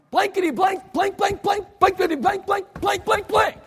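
high, loud pained outburst in stand-in swear words; hammed-up cursing in pain; mock cussing fit. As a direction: cuss up a storm after hitting your thumb. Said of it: "you cuss".

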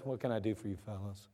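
low, soft, kind question; gentle Jesus. Acted. Jesus gently asking the blind men what they need. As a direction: speak softly and kindly to them. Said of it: "And Jesus says".

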